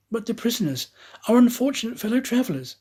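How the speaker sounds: high, hurried pleading; pleading Aouda. Acted. Aouda begging the conductor to wait for the prisoners. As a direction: rush out the plea for them.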